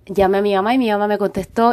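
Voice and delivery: normal talking voice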